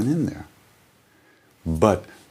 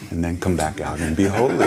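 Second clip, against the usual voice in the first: laughing at the joke; whispered laugh breaking out. Real, not acted. Joke about fake holiness.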